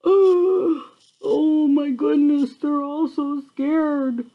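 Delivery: ordinary delivery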